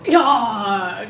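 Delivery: low gruff pirate grunt. Pretend. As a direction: growl it like an old sea dog. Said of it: "a little gruffer there".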